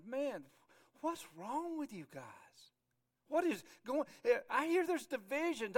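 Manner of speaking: high-pitched, baffled reproach; exasperated. Acted. Apostle writing to the Corinthians about divisions, exasperated. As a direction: call them out in exasperation. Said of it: "he's going".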